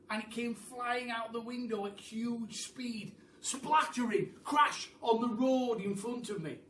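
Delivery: excited telling. A narrator's reading. Furniture crashing down.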